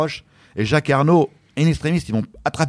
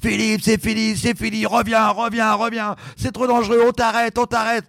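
high, urgent plea to stop; coach calling him back; 9 semitones higher, slower than usual. Retold or imitated. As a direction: voice the frantic coach calling from the boat. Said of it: "mon entraîneur me kiffe".

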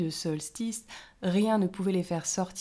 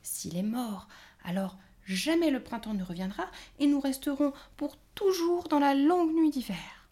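rising distress; 7 semitones higher, 4 dB louder than usual. A narrator's reading. Fairy protests in distress.

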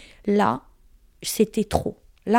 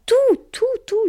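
exasperated outburst; 14 semitones higher, slower than usual. Real, not acted. Énumère ce qu'il prenait.

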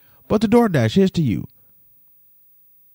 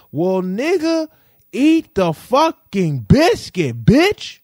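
high, louder, slow furious yell; upset outburst; 6 semitones higher, 4 dB louder, much slower than usual. Real, not acted. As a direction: blow up at the driver. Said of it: "But I was very upset".

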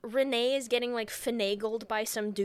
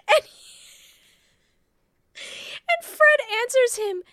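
mock amazed; 9 semitones higher, 6 dB louder than usual. Excited retelling of Fred's reply.

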